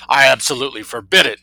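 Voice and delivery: booming outburst; thundering. A narrator's reading. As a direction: forbid it with full authority. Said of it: "thundered the colonel".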